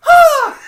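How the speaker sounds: very high, loud panicked shriek; imitated scream. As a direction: shriek like you're being chased. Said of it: "imagine Tony running around going".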